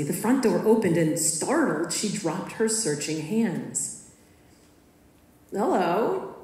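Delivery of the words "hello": calm. A narrator's reading. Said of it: "calmly, but looking surprised".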